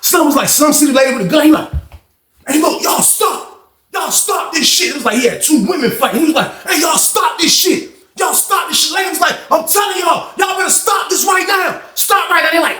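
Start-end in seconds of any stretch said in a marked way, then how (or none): 2.5-4.9 higher, slow mock guard yelling at fighters; high-pitched imitation of a guard shouting stop. 6.7-12.5 high mock female officer shouting stop; imitated high woman's voice yelling at inmates to stop; female guard yelling. Lady officer yelling at fighters.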